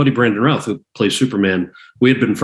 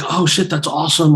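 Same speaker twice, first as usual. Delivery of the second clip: higher-pitched, retold delight; pleased excitement; 7 semitones higher than usual. Actor hears the producers want another take, retold excitement. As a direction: act out being happily surprised. Actor retells hearing of a callback.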